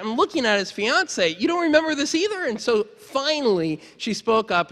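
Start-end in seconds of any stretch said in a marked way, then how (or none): 1.3-2.4 higher, quick incredulous question; retold disbelief. Speaker questioning friend's fiance about the scenes, incredulous. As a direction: turn on them in mock disbelief.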